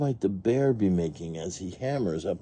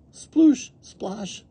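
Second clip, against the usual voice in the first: pedals splashing; 4 dB louder than usual. A narrator's reading. Pedals as Wiggles and Nicky race, splashy noises. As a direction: imitate churning water. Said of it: "go the petals".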